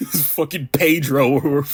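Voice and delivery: slow words through laughter; drawn out, breaking into giggles; laughing. Real, not acted. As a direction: crack up while saying the name. Cracks up at the character's name.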